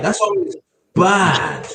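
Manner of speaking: imitating the uncle, drawn out; Jamaican accent. Echoing the uncle's Jamaican line.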